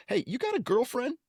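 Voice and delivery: teasing a phone scammer in a high goofy voice. Retold or imitated. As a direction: pester him in a mock dopey voice. Mocking scammer with goofy questions.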